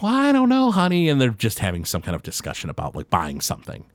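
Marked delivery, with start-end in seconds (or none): 0.0-1.1 high, hesitant wife's voice; a much higher, doubtful "well, I don't know". Acted. Wife hedging with her husband, high and unsure. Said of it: "And she's like".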